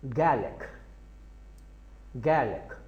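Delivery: गार्लिक said as "gelik" without the r; गार्लिक like a British speaker, no r; English way, no r. Shown as a demonstration. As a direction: drop the r in गार्लिक. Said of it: "अंग्रेज़ जो हैं वो बोलते हैं".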